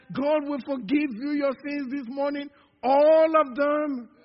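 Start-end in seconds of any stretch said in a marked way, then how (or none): 2.8-4.1 loud challenge. Acted. Preacher challenge.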